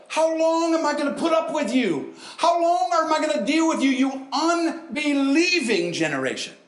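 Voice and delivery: fed-up shout, the last words slowed; exasperated rebuke. Retold or imitated. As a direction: let Jesus boom at his own followers. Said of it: "That's to his boys".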